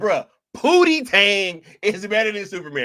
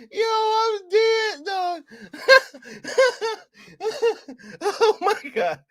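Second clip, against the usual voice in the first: high-pitched words through laughter; barely getting it out while laughing; laughing hard; slower than usual. Real, not acted. Streamer dying laughing at the reviewer.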